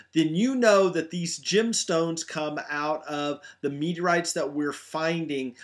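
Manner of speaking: usual speaking voice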